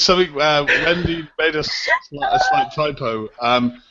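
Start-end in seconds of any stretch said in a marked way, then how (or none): none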